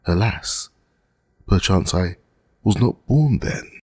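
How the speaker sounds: put-on timid young girl, pitch lifting at the end; meek as a lamb. A narrator's reading. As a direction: plead gently, like a lamb. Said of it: "replied like a lamb".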